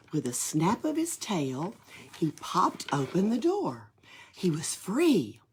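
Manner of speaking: usual speaking voice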